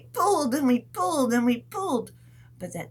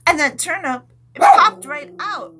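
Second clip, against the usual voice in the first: much higher and louder triumph; excited; 8 semitones higher, 12 dB louder than usual. Pretend. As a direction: burst out with the big moment. Turnip finally comes out.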